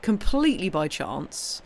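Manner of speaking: ordinary talk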